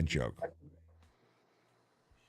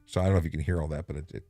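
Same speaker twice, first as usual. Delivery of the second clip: ordinary delivery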